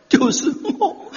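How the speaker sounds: high, slow, laughing "就是我"; a hammed-up high laughing "就是我"; mock men identifying. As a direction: say it like a man who sees himself.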